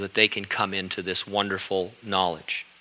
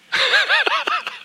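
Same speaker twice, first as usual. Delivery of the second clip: a sudden high laugh; a short high chuckle at his own joke; high burst of laughter. Real, not acted. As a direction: let a real laugh break out. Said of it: "I'm not laughing about it".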